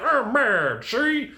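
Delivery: high, hammed-up old-time gangster voice; mock-mad gangster impression; thirties gangster. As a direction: do a comic thirties gangster, jumping up at the end. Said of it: "talking like a pirate or a thirty gangster".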